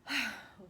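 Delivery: audible sigh before starting; settling sigh. Real, not acted. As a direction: let out a big sigh. Settling before fear session.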